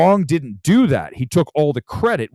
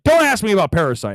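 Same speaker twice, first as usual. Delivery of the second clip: irritated; 7 semitones higher, 4 dB louder than usual. Retold or imitated. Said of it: "shocked that he was like".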